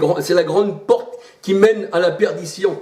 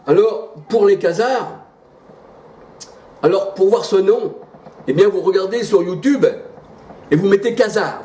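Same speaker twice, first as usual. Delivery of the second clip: higher, insistent pulpit delivery; preaching with raised pitch; fervent preaching; 3 semitones higher than usual. Acted. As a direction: drive the verses home from the pulpit.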